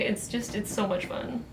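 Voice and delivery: ordinary talk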